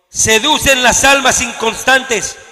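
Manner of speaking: slow, loud scripture reading; lectura enfática. Acted. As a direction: read the verse out with weight. Reads scripture with force. (lectura bíblica lenta y fuerte)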